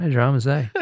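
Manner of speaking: a light Australian lilt on no dramas, eh; Australian accent. Shown as a demonstration. Host tries the Aussie line for the listeners. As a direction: toss it off in a relaxed Australian accent. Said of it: "in an Australian accent as well".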